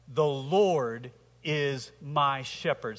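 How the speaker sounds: very slow, weighing each word; slow, deliberate declaration. Shown as a demonstration. Preacher reciting the psalm to the congregation, slow and solemn. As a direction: lay it down slowly, like a creed. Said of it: "Say that with me".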